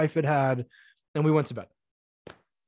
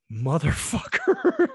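exasperated curse; 9 semitones higher, slower than usual. Retold or imitated. Exasperated reply to burrito confession.

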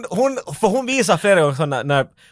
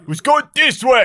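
acted urgency; 10 semitones higher, 4 dB louder, slower than usual. Acted bodyguard taking charge in panic.

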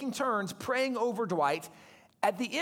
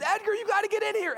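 much higher, calling out in alarm; retold scream; 10 semitones higher, faster than usual. Sick boy screams for older brother, high alarmed call. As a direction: yell for your brother to come quick. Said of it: "Dwight screams for his older brother".

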